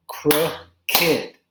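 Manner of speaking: crooked split clearly into crook-ed; crooked, two syllables. Shown as a demonstration. Teacher models crooked for ESL students, two syllables. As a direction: sound out both beats of crooked. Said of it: "That has two syllables".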